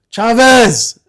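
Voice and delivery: high, loud shout across a distance; calling out. Retold or imitated. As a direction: bellow the name to summon a subordinate. Calling someone far down the line.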